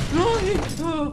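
sudden high frightened yelp; startled cry. Real, not acted. Player jolted by a jump scare, startled yelp.

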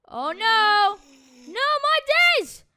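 high, loud alarm; rally the others urgently at high pitch; panicked shouting. Real, not acted. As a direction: shout in a panic mid-fight. Boss turns angry in fight.

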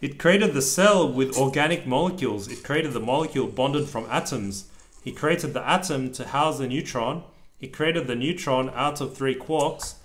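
steady rhythmic picture-book reading; reading aloud to child. A narrator's reading. Dad reading a rhyming book to his child, lilting read-aloud. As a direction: read the rhyme out to your kid.

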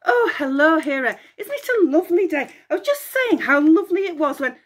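high, fast cheery babble; bubbly nymph voice; chirpy chatter. Acted. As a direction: greet her brightly and babble on. Echo greets Hera.

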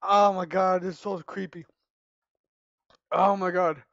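ordinary talk, nothing marked

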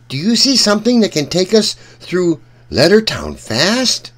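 plain reading voice

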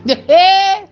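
a real, loud laugh. Real, not acted. Laughs at the story.

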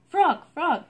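high, loud call of his friend's name; crying out. A narrator's reading. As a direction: call out to Frog at the door. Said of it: "cried Toad".

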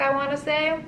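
normal talking voice

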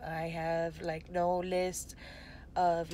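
normal talking voice

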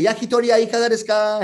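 quick, level-pitched shop promoter's chant; sing-out vendor patter; tissue hander's call. Acted. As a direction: rattle off the street sales call, holding the last syllable. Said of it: "if it's the yakitori shop".

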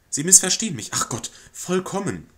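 nervous society lady placating a guest; flustered lady of the house. Acted. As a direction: fluster and over-apologize to the lodger. Lady protests, distressed.

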